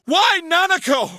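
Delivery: slow, high anguished cry; anguished shout. Acted. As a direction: cry it out in despair. Father learns daughter kidnapped.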